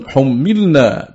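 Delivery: حملنا said hummilna; damma, doubled mim. Shown as a demonstration. Teacher showing one group's reading, حملنا as hummilna with doubled mim. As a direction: hold a double m in حملنا, u before and i after. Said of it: "بضم الحاء وكسر الميم مشددة".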